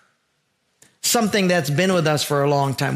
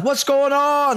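high, raised call of a blind beggar; a blind man calls to the crowd, a raised call; 10 semitones higher, slower than usual. Retold or imitated. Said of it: "he yells out".

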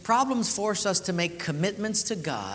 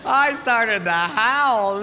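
laughing through words; 5 semitones higher, 9 dB louder, much slower than usual. Real, not acted. Laughing at story.

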